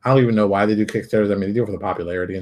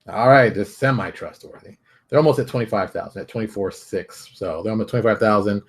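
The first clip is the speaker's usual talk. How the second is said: ordinary talk, nothing marked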